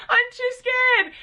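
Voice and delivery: high, slow mock-frightened protest; retold fear. Refusing the biker's help again, high mock-frightened whine. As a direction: play up your own panic on the bike. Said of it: "and I was way too scared".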